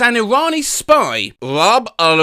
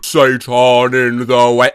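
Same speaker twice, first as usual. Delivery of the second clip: deep demon voice; 8 semitones lower than usual. Acted. Satan voice.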